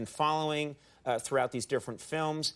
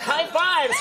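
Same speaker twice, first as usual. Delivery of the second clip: laughing cheer; 12 semitones higher, 7 dB louder, slower than usual. Acted.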